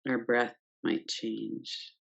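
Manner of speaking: ordinary delivery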